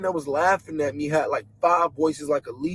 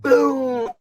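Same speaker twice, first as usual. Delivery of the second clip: high, resounding mouth-made boom; imitated thunder boom; 7 semitones higher than usual. Storyteller recreating thunder from out of this realm, imitated boom. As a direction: do a thunder sound effect with the voice. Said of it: "a thunder".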